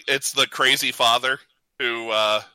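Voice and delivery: usual speaking voice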